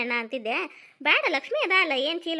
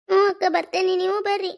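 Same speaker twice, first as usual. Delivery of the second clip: high, sobbing goodbye; crying farewell; 5 semitones higher, 6 dB louder than usual. Acted. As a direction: send them off while crying. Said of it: "ಯಾಕ್ ಅಳ್ತೀರಿ ಕಮಲ". (ಅಳುತ್ತಾ ಬೀಳ್ಕೊಡುಗೆ)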